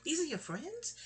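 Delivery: high, soft bewildered questions; a startled little girl asking; confused child. Retold or imitated. Little girl asking Mommy, high bewildered questions. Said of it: "I'm like".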